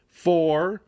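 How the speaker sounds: usual speaking voice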